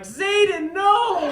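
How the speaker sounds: high, loud anguished yell of a parent; hammed-up cry at a lost child; a dad in court, yelling after his son Zayden, loud and high; mock courtroom scream.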